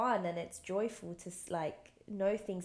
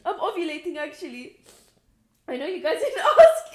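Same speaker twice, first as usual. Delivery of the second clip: laughing through the words, much louder; 7 semitones higher, 17 dB louder than usual. Real, not acted. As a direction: giggle it out. Laughing aside to audience.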